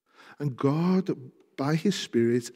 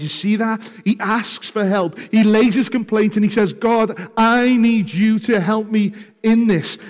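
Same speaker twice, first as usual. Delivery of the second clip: louder, higher fervent proclamation; preaching at full force; 5 semitones higher, 7 dB louder than usual. Acted. Preacher proclaiming the psalmist's trust, fervent and loud. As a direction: proclaim it over the congregation.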